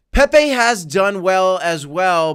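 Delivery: slow hype. Real, not acted. Streamer hyping Pepe to viewers, slow loud hype. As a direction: stretch it out to hype the coin.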